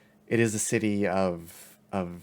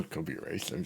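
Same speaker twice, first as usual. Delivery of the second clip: low, hushed, nearly voiceless mumble; mumbling; 5 semitones lower, 9 dB quieter than usual. Acted. Timothy grumbles about the race, mumbling quietly. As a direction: mumble it under your breath. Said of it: "kinda mumbles his".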